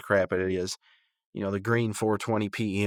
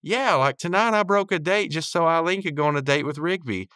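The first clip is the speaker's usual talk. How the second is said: lightly voiced Margaret, raised higher; a light higher-pitched take on Margaret gushing; hint of Margaret's voice, pitched up; imitating Margaret; 7 semitones higher, 4 dB louder than usual. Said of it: "And then Margaret goes".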